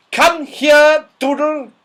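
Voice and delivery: put-on high, loud scolding old dame; Dame Snap, sharp. A narrator's reading. Stern old dame scolding a scruffy pupil, loud and high. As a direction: bark it like a cross schoolmistress. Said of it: "was shouted at very loudly".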